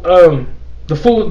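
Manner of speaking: ordinary delivery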